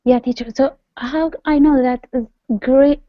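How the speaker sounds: normal talking voice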